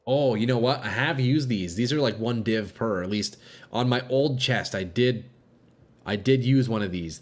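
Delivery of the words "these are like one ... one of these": ordinary delivery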